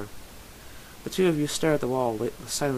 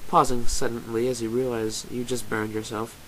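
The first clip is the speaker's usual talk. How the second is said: usual speaking voice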